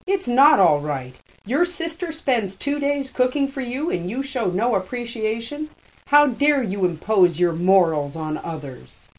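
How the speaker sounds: loud, indignant outburst; angry. A narrator's reading. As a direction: scold him hard and loud. Jean furious at Hammy.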